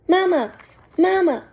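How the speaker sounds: put-on high, loud baby-bird peep; a hatchling begging for food; peeping gosling. A narrator's reading. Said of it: "peeped the gosling".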